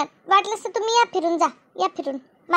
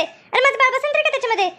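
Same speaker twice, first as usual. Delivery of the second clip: ordinary talk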